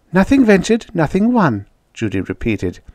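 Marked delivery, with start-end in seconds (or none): 0.1-1.7 put-on high, sing-song girlish voice; undaunted girl. A narrator's reading. Judy brushes off Jane's worry, undaunted. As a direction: recite it like a confident child. Judy's undaunted reply to Jane.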